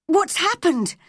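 high, alarmed cry; panicked demand for news; wild cry. A narrator's reading. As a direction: cry out in alarm, pitch leaping up. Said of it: "cried Mary's mother wildly".

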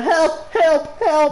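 high-pitched mock plea, laughing; mocking cry for help. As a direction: mimic a teammate's helpless cries. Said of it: "You're the one".

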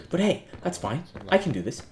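ordinary talk

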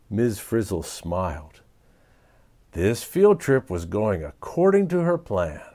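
usual speaking voice

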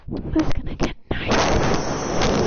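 loud slow unvoiced yell; shouting. Real, not acted. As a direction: bellow it out slowly. Yelling at the camera.

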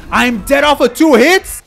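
high, loud, drawn-out disbelief; slow, incredulous yelling; outraged shout. Real, not acted. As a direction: yell in disbelief at the game. Outraged at dying in game.

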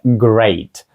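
great said slowly with an "ey" diphthong; pronounced with "ey". Shown as a demonstration. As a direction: correct it to great, like "grate". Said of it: "третій спосіб вимови даного звуку". (great повільно, зі звуком «ей»)

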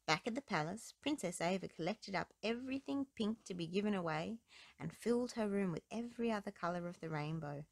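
plain reading voice

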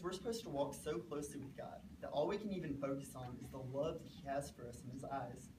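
normal talking voice

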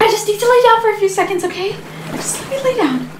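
high, faint, tearful whine; overwhelmed whimper. Acted. Mother needing to lie down, high overwhelmed whimper. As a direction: trail from hurried plea into weak whimper.

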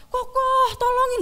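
high, pleading cry for help; little sibling begging for help; pleading brother voice. Pretend. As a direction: beg big brother from the pit. Imagines brother pleading from pit.

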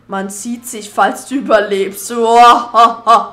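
loud, slow, menacing villain speech; evil dark lord. Acted. Villain leaves with threat.

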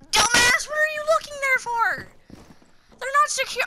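high-pitched, incredulous telling-off; exasperated scolding. Real, not acted. Scolding a player they are watching.

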